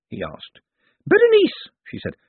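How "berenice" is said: very high, loud little blurt; flustered lady. A narrator's reading. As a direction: blurt the name in a high flutter. Flustered lady gives her name.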